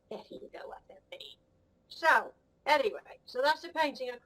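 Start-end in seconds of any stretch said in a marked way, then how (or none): 0.1-1.4 soft unvoiced whisper; whispered aside. Real, not acted. A whispered aside to the camera. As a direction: breathe it as a secret aside.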